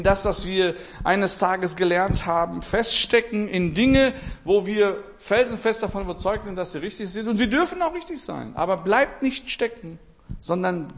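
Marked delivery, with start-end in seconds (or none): none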